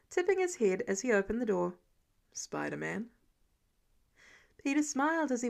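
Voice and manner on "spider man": knowing low reply. A narrator's reading. Matt answers knowingly, voiced low.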